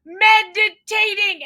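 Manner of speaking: high, loud frantic mom, laughing through it; high, loud mock panic between laughs; panicked mom. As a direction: shriek it like a mortified mother. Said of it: "she says".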